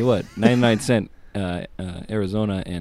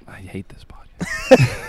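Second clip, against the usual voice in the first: whispered aside; 3 dB louder than usual. Real, not acted. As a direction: breathe it under your breath. Sulking after refusing a bit.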